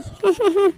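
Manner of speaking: short mocking burst of laughter. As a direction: laugh at the suitor scornfully. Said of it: "زور زور سے ہنسنے لگتی ہے". (طنزیہ ہنسی)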